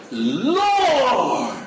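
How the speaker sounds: high, wailing mock-holy "Lord"; showy pious prayer. Preacher mocking people who perform religious words. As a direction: ham up a crying prayer voice. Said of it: "they do it well".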